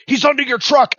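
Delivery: high-pitched urgent warning; alarmed radio call. Retold or imitated. Truck three calling up the driver, high and alarmed. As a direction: imitate a panicked convoy mate. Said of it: "the three truck calls up".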